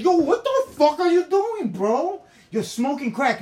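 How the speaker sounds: high, full-volume comic outrage; shocked yelling. Retold or imitated. Friends catching her smoking crack, shocked shouting. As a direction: yell at her in alarm and disbelief. Retold confrontation on seeing crack smoked.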